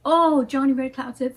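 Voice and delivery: usual speaking voice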